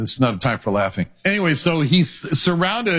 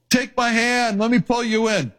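rescuer pleading; 9 semitones higher than usual. Pretend. Rescuer in lifeboat imagined.

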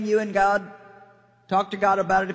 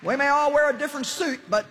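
high, insistent rallying cry; rousing preaching; 6 semitones higher than usual. Real, not acted. Preacher urging all denominations to fight together, rousing preaching. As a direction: hammer out each phrase like a rally speaker.

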